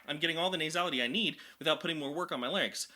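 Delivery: ordinary delivery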